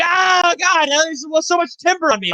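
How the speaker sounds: high, loud mock-child wail; high-pitched kid voice. Imitating his young self under timber, high-pitched wail. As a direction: whine it up high like a crushed kid. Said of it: "in, like, a high-pitched voice".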